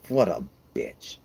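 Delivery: normal talking voice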